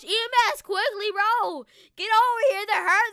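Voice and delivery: role-played emergency yell; urgent calling out. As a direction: summon help quickly across a distance. Calling medics to the injured.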